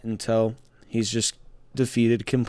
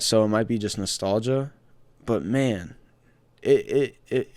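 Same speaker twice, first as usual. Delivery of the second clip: ordinary delivery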